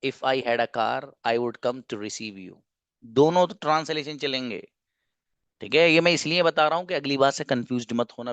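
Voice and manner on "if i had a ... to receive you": each word laid out slowly and softly; slow teaching model. Shown as a demonstration. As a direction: say it slowly for the class to copy. Teacher models sentence slowly, quietly. (धीरे-धीरे, साफ़ बोलकर)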